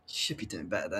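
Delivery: a soft, breathy whisper; whispered aside. Real, not acted. Fan whispering softly in disappointment after a miss. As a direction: mutter it under your breath.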